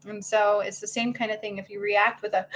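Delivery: ordinary talk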